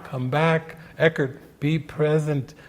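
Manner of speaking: normal talking voice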